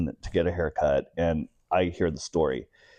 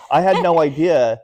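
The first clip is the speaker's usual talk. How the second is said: excited disbelief; 8 semitones higher, 7 dB louder than usual. Real, not acted. Retelling the big surprise.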